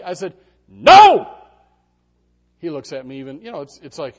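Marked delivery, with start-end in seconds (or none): 0.7-1.4 high, very loud shouted no; dad yelling no at a reaching toddler; sharp shouted no. Retold or imitated. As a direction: stop the toddler's hand with a yell.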